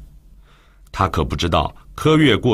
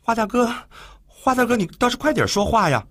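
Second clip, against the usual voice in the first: higher, frightened plea; trembling voice; 11 semitones higher than usual. Acted. Frightened girl pleading in the dark, higher trembling plea. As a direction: beg him to speak, voice shaking. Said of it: "说话的声音都颤抖了起来".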